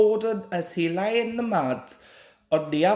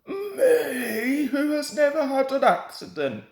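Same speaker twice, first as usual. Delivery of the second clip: higher, quick offended protest; indignant; 6 semitones higher than usual. A narrator's reading. Gordon boasting while fuming, mildly indignant. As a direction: boast in a huff. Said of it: "letting off steam".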